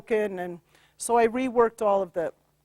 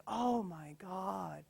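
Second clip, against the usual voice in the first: slow, much softer, awestruck; awed hushed wonder; 3 semitones lower, 17 dB quieter, much slower than usual. Retold or imitated. Client entering the green forest, hushed awe. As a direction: breathe it out in amazement. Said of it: "she's just like".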